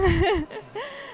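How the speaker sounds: a quick, bright real laugh; short laugh. Real, not acted. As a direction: burst out laughing in delight. Interviewer laughs at winner news.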